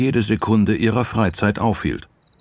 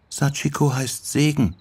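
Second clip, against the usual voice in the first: lightly voiced girl, higher pitch; girl answering; 8 semitones higher than usual. Sachiko explains her name to Yoko, simply.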